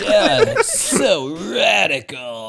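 slow, high stoner drawl of radical; surfer dude. Retold or imitated. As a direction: stretch it out like a surfer. Echoing Mikey turtle bit.

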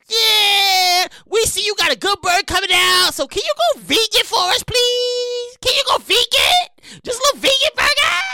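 high, loud whiny begging; mock pleading PETA. Mocking PETA asking for a vegan burger, high and whiny. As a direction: beg like an activist. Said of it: "peta was like".

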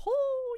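high, loud "whoo"; high whooping exhale. Real, not acted. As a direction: let out an overwhelmed high whoop. Overwhelmed by her own episode.

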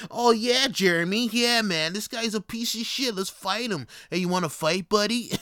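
loud, high, jeering frat-bro voice; mocking drunk bro. Mocking drunk bros who pick fights with strangers, loud high jeering voice. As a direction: sneer it like a meathead picking a fight.

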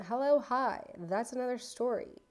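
usual speaking voice

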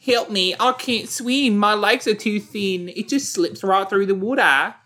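high, whiny helpless swimmer; whiny high-pitched voice. Pretend. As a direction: whine it like a helpless weakling. Voicing skinny-legged swimmer.

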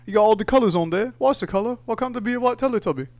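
high put-on Cockney accent; mock Cockney accent. Mates in a cafe complaining, high Cockney accent. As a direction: whine it in a hammed-up Cockney accent. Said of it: "Cockney accents like Michael Caine".

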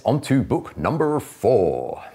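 slow announcement. Real, not acted. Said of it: "host announcing next section".

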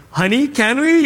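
high-pitched mock coaxing; pleading. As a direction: wheedle your spouse for a treat. Retold pleading with spouse.

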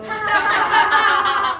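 loud burst of laughter; hearty laugh. Real, not acted. Lachen über Pegots Hose. (lautes Lachen)

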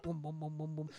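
soft "boom" bass notes; imitated bass line. Bassist joins the song, imitated with soft booms. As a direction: mouth a steady bass part. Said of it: "Matthew Lillard's like".

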